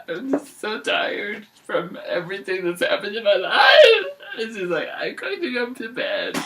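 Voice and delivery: high, whiny fake crying; mock weepy. Goldilocks after breaking the chair, high hammed-up whimper. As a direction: sob it out in comic self-pity.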